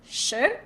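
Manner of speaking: "Sure" gliding upward like a question; second tone. Shown as a demonstration. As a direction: model "Sure" with a rising tone, R barely reached. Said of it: "let's give it a second tone".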